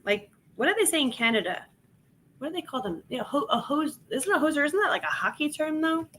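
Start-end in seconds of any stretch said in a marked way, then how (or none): none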